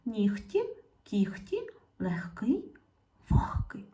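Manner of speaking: "нігті" said as нiхтi, with the г devoiced to х; careful teacher pronunciation of "легкий" and "вогкий" with х; pronunciation demo. Shown as a demonstration. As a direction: say "кіхті" with a voiceless х. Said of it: "Можете повторити разом".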